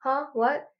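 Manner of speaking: ordinary talk, nothing marked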